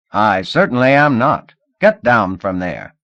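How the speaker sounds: angry order. Acted.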